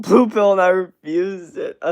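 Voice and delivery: real laugh breaking into the sentence; laughing while talking. Real, not acted. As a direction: crack up while reading it out. Laughing while reading a chat joke.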